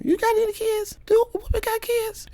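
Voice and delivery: very high mocking falsetto; high falsetto taunt; mock squeaky voice. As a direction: squeak the question in a silly voice. Mocking retort to Whoopi.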